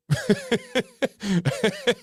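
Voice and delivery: high giggling laugh; real amused cackle. Real, not acted. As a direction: crack up in a long run of giggles. Amused by the few wives joke.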